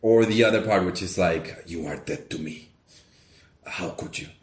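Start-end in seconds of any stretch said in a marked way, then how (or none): 1.7-4.3 low, soft, put-on vampire father disowning his son; a lightly done Dracula, dropping to a breathy hush at the end; Dracula impression. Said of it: "[name]'s impression of Dracula".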